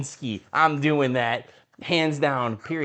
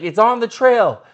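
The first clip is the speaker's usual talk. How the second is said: guide calling out; 8 semitones higher, 8 dB louder than usual. Retold or imitated. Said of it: "He's like, he's like".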